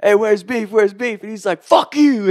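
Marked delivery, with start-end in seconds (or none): 0.0-1.2 high, loud shouted question; urgent loud call. Retold or imitated. Retold calling out to passing runner. 1.6-2.3 high, loud yelled curse; furious retold shout; angry cursing. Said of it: "saying all this crazy shit to us".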